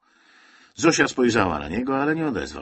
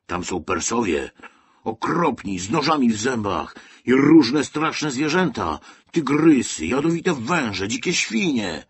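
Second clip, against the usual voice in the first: building higher and louder into menace; scaring; 5 semitones higher, 6 dB louder than usual. A narrator's reading. Boy frightens the children about Persia, menacing and excited. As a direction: spin a scary list to frighten little ones. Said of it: "straszył Józek".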